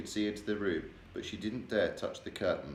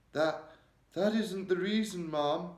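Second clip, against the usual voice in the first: quivering; 10 semitones higher, 3 dB louder, slower than usual. A narrator's reading. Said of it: "The maid's voice was quivering terribly".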